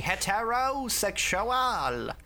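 Merrick. Acted. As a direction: declaim it slowly as a hammy villain. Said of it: "I wish Merrick would stop shouting all of the time".

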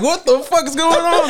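high, slowed-down alarmed cry; the uncle suddenly panicking as the helicopter drops. Retold or imitated. Uncle voiced as SWAT arrives.

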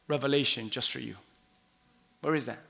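ordinary delivery

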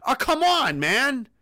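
ordinary delivery

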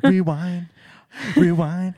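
high, loud mock tape rewinding; rewind sound effect. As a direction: sing out rewind like a tape spooling back. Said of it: "that's a better rewind sound".

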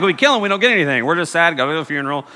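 ordinary delivery

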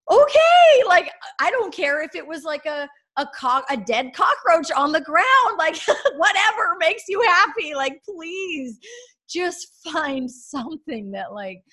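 a mother overjoyed her son is happy; overjoyed mom. Retold or imitated. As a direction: pour out relief and joy in a high voice. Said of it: "And I was just like".

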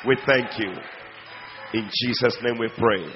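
prayer. Acted. Opening prayer.

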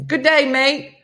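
high, loud mock Aussie greeting that slips British; hammed-up Aussie greeting; failed Australian accent. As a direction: try to sound Australian but land on Mary Poppins. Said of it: "You sound like Mary Poppins".

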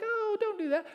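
high-pitched timid plea; mock weak objection; meek protest. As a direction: squeak a feeble protest. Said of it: "we're just like".

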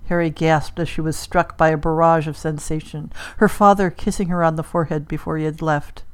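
usual speaking voice

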